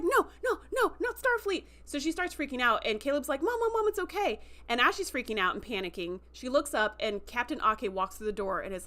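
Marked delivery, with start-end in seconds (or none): 0.0-1.6 high-pitched mock panic; freaking out. Mother waking to see the Starfleet badge, acted freaking out. 3.4-4.4 high, urgent imitation of a pleading son; anxious soothing. Caleb to his panicking mom, anxious soothing.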